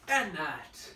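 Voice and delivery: ordinary talk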